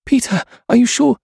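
high, frightened hesitation; anxious question. A narrator's reading. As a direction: ask it high, scared to climb down. Frightened friend hesitates at rail.

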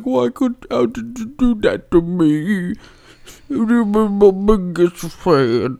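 high-pitched, hammed-up heartbroken voice; Jake. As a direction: play the betrayed pal, high and drawn out. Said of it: "Jake will be like".

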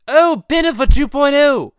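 very high, loud gleeful outburst; excited squeal. Acted. Stewie spotting a celebrity couple costume, loud glee. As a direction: exclaim it in a high, thrilled cartoon voice.

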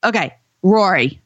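Roy said as "Rory", an extra r syllable; "Rory" with two syllables, r-o-r-y, for Roy; says Rory for Roy. Shown as a demonstration. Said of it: "what is the word R O Y".

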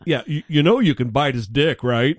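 lightly put-on hillbilly accent; mild mock hillbilly accent. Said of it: "I'm doing a hillbilly accent".

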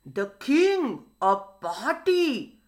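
mildly excited, drawn-out exclamation; a touch of storybook wonder. A narrator's reading. Crocodile hearing of the king's party, mildly excited. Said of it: "said the crocodile excitedly".

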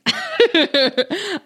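high self-mocking burst of laughter; real laugh. Real, not acted. Joking about being single, a high laugh. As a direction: laugh at yourself.

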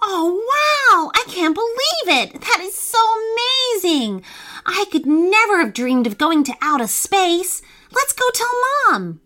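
bursting with joy at the news; thrilled child. A narrator's reading. Young fox thrilled about going to space, high and squealing.

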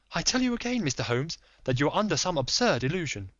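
lady pleading secrecy; Lady Hilda. Acted.

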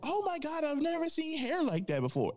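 very high-pitched mock amazement; put-on high voice, exaggerated astonishment. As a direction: imitate a gawking woman in squeaky falsetto.

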